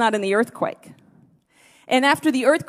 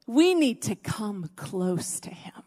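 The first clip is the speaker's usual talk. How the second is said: slow whisper; 3 semitones lower, 7 dB quieter, slower than usual. Real, not acted. Preacher drawing the people close, slow whisper. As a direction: whisper it slowly, much softer.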